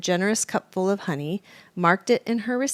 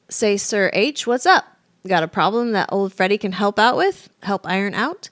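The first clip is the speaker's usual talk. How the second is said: cocky fox; 4 semitones higher, 6 dB louder than usual. A narrator's reading.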